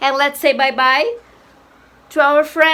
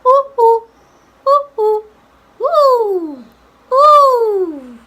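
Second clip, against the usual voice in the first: high owl hoots, short then long; high hoots stretching out at the end; 7 semitones higher, 4 dB louder, slower than usual. Shown as a demonstration. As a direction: demonstrate an owl hooting high. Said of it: "Something like this".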